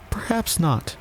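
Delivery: high, tentative reply; cautious. A narrator's reading. Alice answering the scornful Hatter, cautiously. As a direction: answer carefully in a small high voice. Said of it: "Alice cautiously replied".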